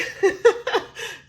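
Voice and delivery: unvoiced high giggle at her own joke. Real, not acted. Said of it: "the girl's a thug".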